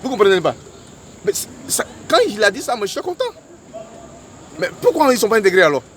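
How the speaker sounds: high, loud, indignant rhetorical questions; heated arguing. Real, not acted. Heated argument about integrating doctors. (argumentation enflammée, voix haute et forte)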